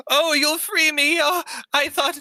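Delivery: a tiny gnome near tears with relief; tearful gnome. Acted. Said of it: "tears well up in the gnome's eyes".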